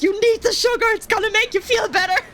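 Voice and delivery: a hint of a pushy friend coaxing; imitating Dini. Dini pushing Coke on her, lightly imitated insistence. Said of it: "I remember Dini, like, practically force feeding me Coke".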